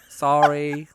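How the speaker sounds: ordinary delivery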